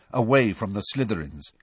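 usual speaking voice

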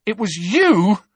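high, slow, shocked realisation; drawn-out accusation in disbelief; gasped. A narrator's reading. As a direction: gasp it out, stunned. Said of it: "gasped Harry".

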